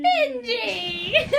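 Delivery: high, loud laughing witch call. Pretend. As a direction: shriek the name through laughter.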